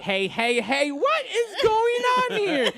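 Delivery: drawn-out, rising, loud scolding catchphrase; hammed-up principal breaking up trouble; Mister Belding. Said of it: "Oh, Mister Belding".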